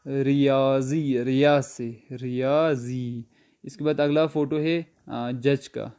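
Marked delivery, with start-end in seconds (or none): 0.1-3.2 ریاضی drawn out slowly, syllable by syllable; slow model pronunciation. Shown as a demonstration. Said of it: "تو math کو ہم فارسی میں کیا پڑھیں گے؟".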